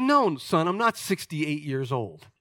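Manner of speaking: normal talking voice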